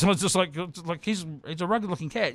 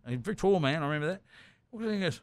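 ordinary talk

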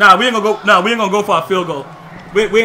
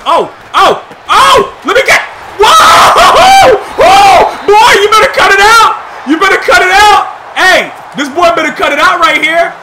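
much higher, much louder hype yells; excited yelling; 8 semitones higher, 8 dB louder than usual. Real, not acted. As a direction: scream it out over a huge play. Big play, yelling at opponent.

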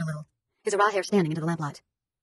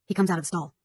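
ordinary delivery

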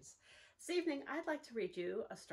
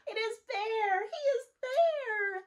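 much higher, louder joyful cry; excited squawk; 12 semitones higher, 11 dB louder, slower than usual. Acted. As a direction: cry out in relief at finding him. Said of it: "squawk from the sky".

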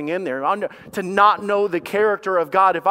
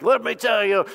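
lightly mocked pulpit delivery, pitched higher; a hint of a showy preacher winding up; preachy pastor; 9 semitones higher, 4 dB louder than usual. Preacher mocking how pastors rush past this part, hammed-up pulpit voice. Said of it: "this is where pastors would go like".

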